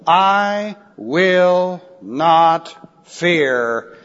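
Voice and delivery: very slow, long gaps between words; drawn-out, weighty verse reading; slow, deliberate recitation. Acted. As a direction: let every word stand alone. Repeats verse word by word.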